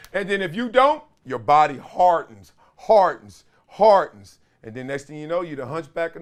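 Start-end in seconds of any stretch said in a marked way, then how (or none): 1.4-4.4 "hardens" punched louder with each repeat; building emphasis. Real, not acted. Host warning viewers what happens if you don't, hammering "hardens". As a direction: hammer "hardens" harder every time, each one falling.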